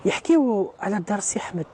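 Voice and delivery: measured Arabic tale-telling with pauses between phrases; recited folk tale, drawing out key words; traditional storytelling. Acted. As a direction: tell it like an old legend, phrase by phrase.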